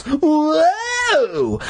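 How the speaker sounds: very high, drawn-out whoa; exaggerated cry of astonishment; amazed cry. A narrator's reading. Joe seeing the drawings on the melons, crying out amazed. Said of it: "I cried in amazement".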